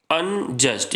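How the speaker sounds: "Unjust" in two beats, अन, then just; brief break between अन and just in "unjust"; teacher counting out the two parts of "unjust"; split into syllables. Shown as a demonstration. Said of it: "यहाँ पे भी देखिए दो syllable है".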